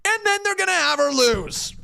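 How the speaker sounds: venting that it always happens; fed-up fan yelling at the booking; frustrated rant. Real, not acted. Ranting about babyfaces losing.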